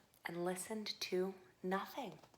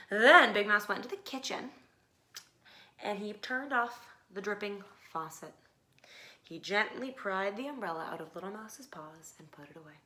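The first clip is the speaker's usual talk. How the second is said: usual speaking voice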